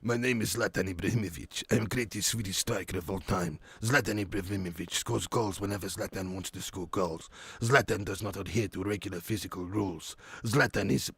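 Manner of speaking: put-on low, soft Swedish-accented boasting; deadpan self-praise, low-pitched, in Swedish accent; deep, quiet Swedish footballer voice; Zlatan Ibrahimovic impression. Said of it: "You like my Zlatan?".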